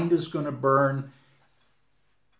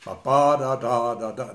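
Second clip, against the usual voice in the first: "Ba, ba, da, da" chanted as a short-long pulse, fading; iambic beat; 2 semitones lower than usual. Shown as a demonstration. Lecturer sounding out iambic pentameter, rhythmic nonsense syllables. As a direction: tap out "da, da" in a regular meter, letting it drop away. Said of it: "Now, that's iambic".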